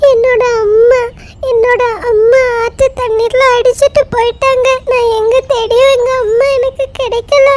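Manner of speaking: put-on high, crying child's voice; weeping little lamb; tearful sobbing. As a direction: sob out the loss. Lamb sobbing about lost mother.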